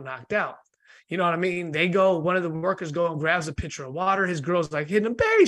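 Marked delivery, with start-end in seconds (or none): 5.1-5.5 sharp, high, loud call; mock frantic cry. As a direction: imitate a girlfriend desperately calling him. Girlfriend trying to rouse him.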